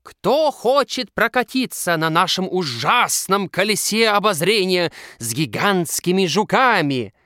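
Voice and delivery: high, loud spooky barker; creepy voice. Acted. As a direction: lure them in, ghoulishly. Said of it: "Жутким голосом приветствует их Наташа".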